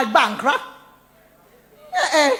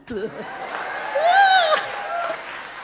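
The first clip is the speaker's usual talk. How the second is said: high delighted laugh; 3 dB quieter than usual. Real, not acted. Big laugh after the poem.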